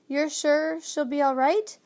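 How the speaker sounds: worried plea for reassurance; anxious. A narrator's reading. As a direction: ask with mild worry. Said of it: "the boy asked anxiously".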